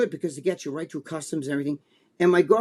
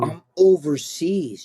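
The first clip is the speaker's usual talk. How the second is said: slow, stretched-out overseas; drawn-out; slower than usual. Real, not acted. Telling Joe about the long trip, slow and weary. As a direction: drag out overseas in misery. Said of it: "stretched complaint".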